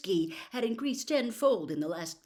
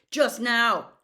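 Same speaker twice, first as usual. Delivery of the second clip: louder, strained restraint; barely held yell; 4 semitones higher, 10 dB louder than usual. Acted. Harry answering Dumbledore while fighting not to yell, barely held rage. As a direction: hold back a yell. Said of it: "refraining from yelling".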